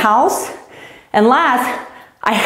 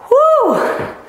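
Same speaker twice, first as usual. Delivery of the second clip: excited whoop. Real, not acted. Coach cheering on kids.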